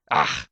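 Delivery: sudden loud cry as he slips; startled cry. Acted. Slipping unicorn cries out, loud and startled. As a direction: yelp of alarm, loud.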